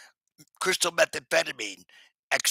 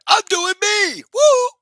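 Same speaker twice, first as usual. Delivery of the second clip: much higher, loud cheering cry; joyful whoop; 11 dB louder, slower than usual. Real, not acted. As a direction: celebrate yourself with a big woo.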